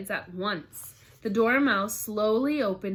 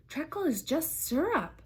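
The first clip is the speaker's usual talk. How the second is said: put-on young girl voice; 9 semitones higher than usual. Alice's objection voiced.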